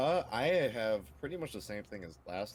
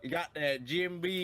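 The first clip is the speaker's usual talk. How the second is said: put-on Southern accent; 5 semitones higher than usual. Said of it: "make fun of your Southern accent".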